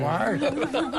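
rhythmic Shandong-dialect patter with clapper beats; Shandong kuaishu in Jinan dialect. Shown as a demonstration. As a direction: chant it like a Shandong kuaishu storyteller. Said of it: "我给你唱个小段儿行吗". (济南话山东快书说唱)

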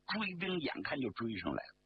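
plain reading voice

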